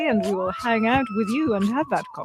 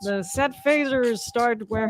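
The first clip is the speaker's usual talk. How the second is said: usual speaking voice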